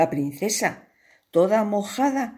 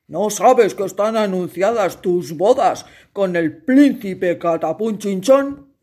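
louder, gruff scolding king; stern king; 5 dB louder than usual. Acted. King scolds his daughter, loud and stern. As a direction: scold your silly daughter.

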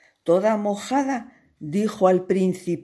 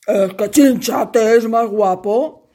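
louder, gruff scolding king; stern king; 3 semitones higher, 7 dB louder than usual. Acted. King scolds his daughter, loud and stern. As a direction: scold your silly daughter.

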